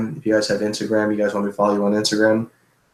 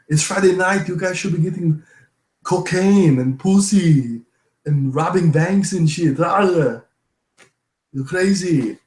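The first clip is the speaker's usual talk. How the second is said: higher, drawn-out Austrian strongman voice; hammed-up Austrian accent with stretched vowels; Arnold; 6 semitones higher, slower than usual. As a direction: play an Austrian action star egging people on. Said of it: "I can do Arnold all day".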